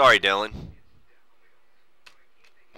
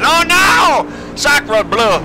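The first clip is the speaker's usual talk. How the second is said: put-on shrieking French accent, much higher and louder; panicked French cry, hammed up; 10 dB louder, much slower than usual. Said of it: "I'm French".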